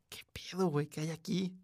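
hushed, uneasy question into the dark; scared whisper alone in the room; frightened whisper. Retold or imitated. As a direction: whisper it, spooked. Narrator scared in the house.

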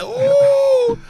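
high, loud drawn-out "ooh"; big mock-stunned ooh; loud reaction ooh. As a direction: let out a long high whoop of shock. Reacting to retold suplex.

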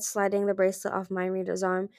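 ordinary delivery, nothing marked

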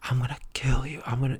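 whispered threat right in the face; menacing whisper. Retold or imitated. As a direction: hiss the threat close up. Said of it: "he's just all up in my face, like".

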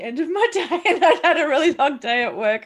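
loud laughter breaking through the words; cracking up mid-sentence; laughing while talking. Real, not acted. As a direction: tell it through a big, rising laugh. Laughs recalling her long workday.

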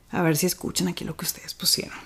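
voiceless, soft aside; whispered aside. Real, not acted. Vlogger checking whether viewers can hear, whispered. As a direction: lean in and whisper it.

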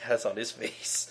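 ordinary talk

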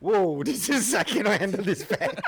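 high, laughing amazement; laughing disbelief. Retold or imitated. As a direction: gasp it out through laughter. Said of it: "I'm like".

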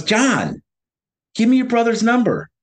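high, insistent demand; pressing, pitched well up; urgent friend, retold. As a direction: act out a friend trying to get through to a confused man. Friend urging confused man on phone.

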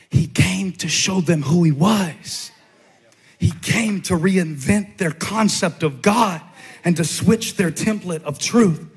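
high, driving sermon delivery; full-force preaching. Real, not acted. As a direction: preach it with building intensity, up into a shout.

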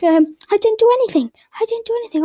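high, nervous pleading; a frightened boy insisting; high, anxious protest; scared denial. Acted. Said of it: "So he's like".

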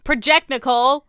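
projecting loudly. Acted. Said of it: "she has the gentlest voice".

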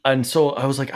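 ordinary talk, nothing marked

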